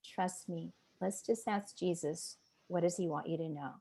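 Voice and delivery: retold tender coaxing; calm reassurance. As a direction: answer the protest gently and quietly. Retold leader calming her protest.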